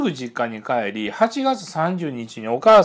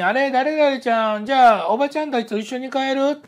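higher, quicker, lightly voiced kindly auntie; neighbor auntie; 12 semitones higher, 4 dB louder than usual. Retelling the neighbor auntie inviting the child, higher and quick. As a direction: offer the lonely child a ride home, higher and quick.

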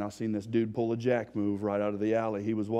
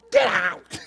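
loud yelled order; angry shout; 9 semitones higher, 13 dB louder than usual. Retold or imitated. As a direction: bark her out of the room. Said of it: "She was like".

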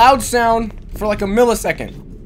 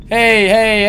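normal talking voice

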